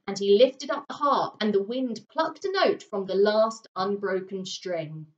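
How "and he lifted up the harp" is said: usual speaking voice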